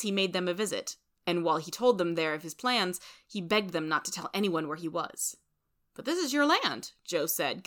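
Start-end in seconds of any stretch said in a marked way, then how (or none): 5.9-6.9 high-pitched mild bafflement; puzzled objection, pitched up; confused. A narrator's reading.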